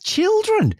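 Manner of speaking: put-on very high questioning "Children"; mock puzzled repetition. Repeating Becca's correction back, mock puzzled "Children". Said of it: "I'm like".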